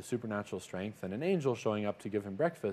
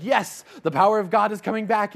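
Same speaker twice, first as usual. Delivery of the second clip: excited; 11 semitones higher, 10 dB louder than usual. Acted. Elijah hopeful at each sign.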